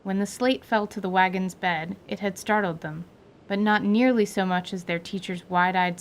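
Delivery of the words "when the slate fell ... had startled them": ordinary delivery, nothing marked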